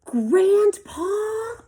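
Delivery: calling out. Acted. Felix calls for help.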